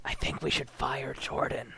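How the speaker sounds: low secret whisper. Pretend. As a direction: whisper it like a conspiracy. Conspiratorial whisper to group.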